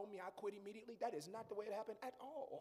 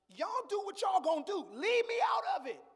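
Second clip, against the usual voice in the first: much higher, louder shout of refusal; Joseph refusing, shouting; 12 semitones higher, 14 dB louder than usual. Retold or imitated. Joseph refusing the angel, a loud high shout. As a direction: throw it back at them, loud and high. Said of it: "Joseph was like".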